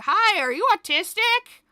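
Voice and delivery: loud, high fake-cheery stranger; asking a stranger, hammed up loud; mock stranger. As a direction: overeager stranger barging up. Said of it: "be like".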